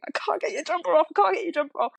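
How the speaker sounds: high, frantic repeated plea; flustered mum losing it; mock frazzled panic. Frantic mum struggling with jumper.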